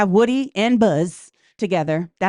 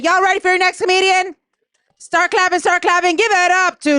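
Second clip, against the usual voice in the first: hype call; 10 semitones higher than usual. Real, not acted. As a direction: pump up the sleepy crowd. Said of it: "I said".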